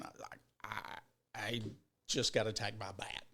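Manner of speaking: soft, low and rattled; low, nearly lost-for-words murmur; subdued retold reply. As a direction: act out a small, stunned admission. Retells own rattled answer.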